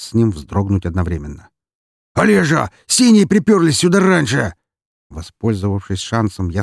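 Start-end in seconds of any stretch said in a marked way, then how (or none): 2.1-4.5 alarmed shout. Acted. Thug shouts alarm about police.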